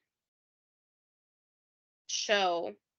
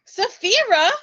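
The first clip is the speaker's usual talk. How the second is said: "Slewfoot?" squeaked much higher and louder in mock amazement; mocking disbelief; 11 semitones higher, 10 dB louder than usual. Hosts mock the strange name with a high, loud incredulous squeak. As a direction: toss "Slewfoot?" up high like you can't believe he said it. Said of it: "We were like".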